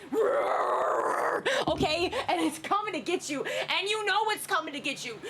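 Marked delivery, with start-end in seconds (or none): 0.1-1.4 long, rough dinosaur roar; T-Rex roar. Retold or imitated. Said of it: "usually with a T-Rex, right? You're just like".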